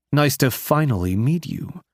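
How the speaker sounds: "finally" stressed above the other words; emphasis on finally. A narrator's reading. Biker greeting the woman who got away, stressing "finally". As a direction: lean pointedly on "finally". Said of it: "Emphasis on finally".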